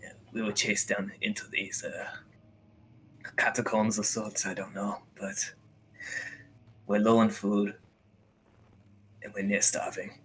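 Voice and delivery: a hushed, weary prisoner, often dropping to a whisper; trapped prisoner. Acted. Trapped man pleads through the wall to strangers, hushed and weary.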